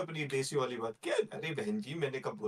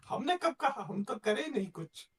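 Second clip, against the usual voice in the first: fussy uncle voice; 10 semitones higher than usual. Retold or imitated. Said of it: "फूफा वाला character".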